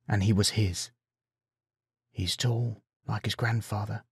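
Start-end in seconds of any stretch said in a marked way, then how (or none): 2.1-4.0 hushed, wondering murmur; inner thought. A narrator's reading. Father privately takes in his grown son, hushed thought. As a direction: say it very softly, to yourself. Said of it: "came a thought in the back of his mind".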